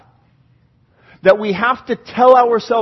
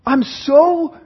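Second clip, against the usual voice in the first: loud, higher plea to God; raised voice, drawn-out cry; 5 semitones higher, 5 dB louder, much slower than usual. Acted. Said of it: "he cries out again, and he goes".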